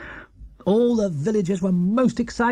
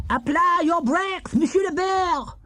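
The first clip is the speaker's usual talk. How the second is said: put-on high, slow French-accented call; yelling French man; 13 semitones higher than usual. A narrator's reading. French spectator yelling at Paddington to brake. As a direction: shout a warning to the bear in a French voice. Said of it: "yelled a man".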